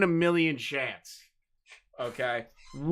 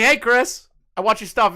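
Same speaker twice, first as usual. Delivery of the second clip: higher, louder mock greeting; imitated friendly fan; 8 semitones higher, 5 dB louder than usual. Fan greeting the streamer in public, mock eager. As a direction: call out like a keen viewer.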